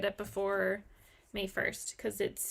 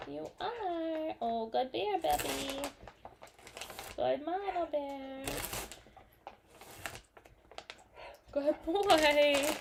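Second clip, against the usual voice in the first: pet talk; 4 semitones higher, slower than usual. Real, not acted. Praising the dog on camera.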